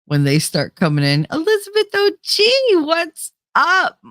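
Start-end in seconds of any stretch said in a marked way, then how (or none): 1.3-4.0 high-pitched hyped hello; playful high-pitched shout-out; excited greeting. Acted. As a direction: greet an arriving regular in a high voice. Acted greeting as viewers come in.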